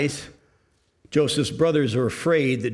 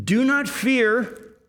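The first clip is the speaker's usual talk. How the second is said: comforting; 9 semitones higher, much slower than usual. Acted. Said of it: "And he then says".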